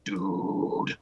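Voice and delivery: very low, quiet creature growl; Geodude voice. Shown as a demonstration. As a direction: push it deep down into the throat. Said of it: "I'd be like".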